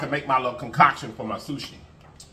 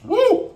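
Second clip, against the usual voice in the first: high, loud "woo!"; wasabi-burn whoop; 7 dB louder than usual. Real, not acted. Eater hit by wasabi in her nose, high whoop.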